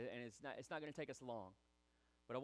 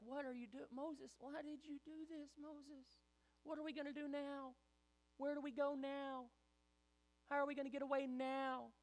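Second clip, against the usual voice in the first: mock panicked whining; 9 semitones higher than usual. Israelites trapped at Red Sea to Moses, high whining. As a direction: whine at your leader, pitched way up.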